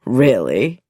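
a doctor toying with her; lightly amused disbelief. Acted. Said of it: "Nicholson's voice sounded amused".

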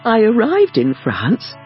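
ordinary delivery, nothing marked

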